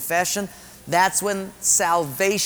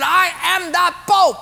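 put-on high shouted Portuguese-accented insistence; 13 semitones higher, 6 dB louder than usual. Patient insisting he is Pope, shouted Portuguese accent. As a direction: shout each word in a comic Portuguese accent. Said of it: "He said".

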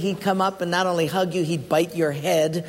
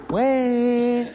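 [name] calling; 6 semitones higher than usual. Retold or imitated. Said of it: "I said".

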